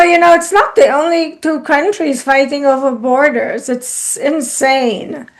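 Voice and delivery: ordinary talk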